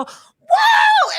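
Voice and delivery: very high, loud whoop; one long joyful scream; celebratory whoop. Retold or imitated. Imitating people on the street reading the news, loud whoop. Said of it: "take their phones out and then go".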